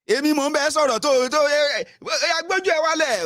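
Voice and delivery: very high-pitched rant; berating elder. Acted. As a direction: scold in a squawking torrent. Acting an elder berating a youth.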